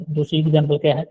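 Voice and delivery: normal talking voice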